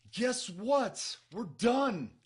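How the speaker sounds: high, loud exclamation; bursting out at the cliffhanger; excited announcement. Real, not acted. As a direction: call it out big and punchy. Announces abrupt stop at cliffhanger.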